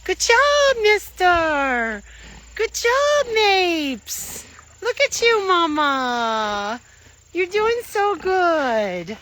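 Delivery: high sing-song praise for a pig; cooing at the pigs; pet talk. Real, not acted.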